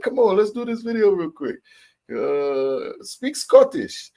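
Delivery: high, pushy parent voice, drawn out at the end; a parent nagging a sleepy kid to perform for a video; mock parent urging in a high voice; parent coaxing. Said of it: "our parents be like".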